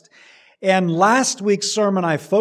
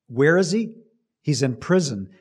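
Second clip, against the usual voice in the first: ordinary talk, nothing marked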